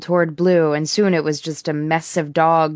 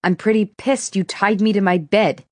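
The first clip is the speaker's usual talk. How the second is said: shout; 4 semitones higher than usual. A narrator's reading. Sydney to Merle about being tied to her bed: angry shout. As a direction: blurt it out as an unintended shout. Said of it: "I didn't mean to shout".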